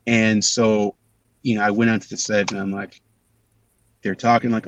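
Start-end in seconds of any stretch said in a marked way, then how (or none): none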